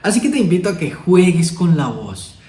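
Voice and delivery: normal talking voice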